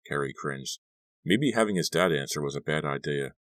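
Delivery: usual speaking voice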